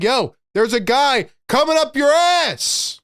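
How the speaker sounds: loud mock call to a teammate; imitated shout. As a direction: shout it like a teammate calling out danger. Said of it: "I need somebody to step up and be like".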